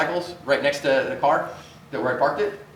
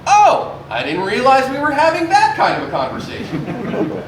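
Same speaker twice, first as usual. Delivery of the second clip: mock surprise; 8 semitones higher, 4 dB louder, slower than usual. Mock shock at the curse.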